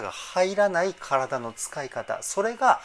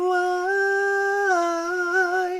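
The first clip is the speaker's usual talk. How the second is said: high, slow falsetto try; falsetto attempt; 5 dB louder, much slower than usual. Shown as a demonstration. Coach trying again to match the singer's falsetto, high and slow. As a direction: reach for the singer's smooth head voice. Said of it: "こんな感じかな".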